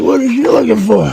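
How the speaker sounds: high, puzzled quote of himself. Retold or imitated. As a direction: ask it up high, baffled. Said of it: "I'm like".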